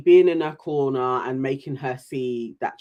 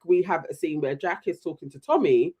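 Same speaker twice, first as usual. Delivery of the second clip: usual speaking voice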